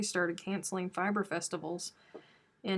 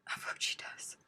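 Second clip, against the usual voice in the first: hushed unvoiced aside, a secret to chat; whispered aside; 9 dB quieter than usual. Real, not acted. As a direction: whisper it so she won't hear.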